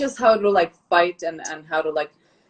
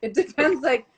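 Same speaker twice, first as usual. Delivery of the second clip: laughing through the words, higher pitched; amused laughter; 5 semitones higher than usual. Real, not acted. Guest joking to the interviewer about her languages, amused and laughing. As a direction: joke with a laugh breaking in.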